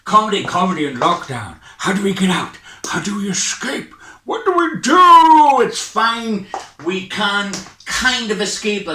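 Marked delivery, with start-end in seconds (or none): 2.8-3.9 conspiratorial whisper; hushed mock worry. Mock panic aside. 4.2-5.7 high, loud, slow panicked cry; hammed-up alarm; mock panic.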